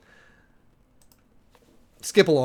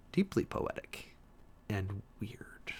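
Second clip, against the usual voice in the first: whispered aside; 3 semitones lower, 8 dB quieter, much slower than usual. Real, not acted. Marvelling aside.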